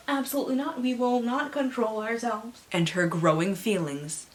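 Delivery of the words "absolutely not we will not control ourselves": voice of the powers. Pretend. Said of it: "Powers, please control yourselves".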